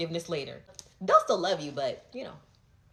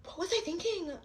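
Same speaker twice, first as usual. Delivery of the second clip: mock realization; 10 semitones higher, 5 dB quieter than usual. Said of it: "is gonna make me be like".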